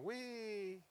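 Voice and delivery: put-on high, gleeful little-girl wee; gleeful child squeal. Young daughter on her bike, squealing with glee. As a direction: squeal it like a kid flying downhill.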